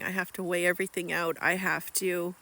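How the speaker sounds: usual speaking voice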